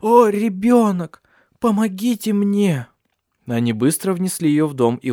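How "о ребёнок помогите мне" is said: high, drawn-out wail of pain; loud, desperate plea, slowly stretched; anguished cry for help. Acted. Injured pregnant woman begging for help, anguished cry.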